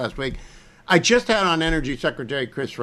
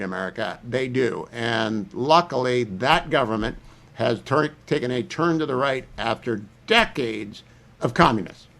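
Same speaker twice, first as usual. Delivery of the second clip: ordinary talk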